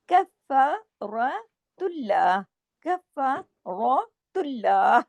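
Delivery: slow drawn-out tajweed recitation; Arabic recited with careful tajweed; Quranic recitation. Shown as a demonstration. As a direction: recite it slowly, drawing out the sounds. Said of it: "हाँ की आवाज आपके गले में आना चाहिए".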